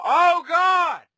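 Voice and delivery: high loud oh god; real outcry. Real, not acted. Player takes a double spec and cries out high and loud. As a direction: cry out at the big hit. Getting hit hard.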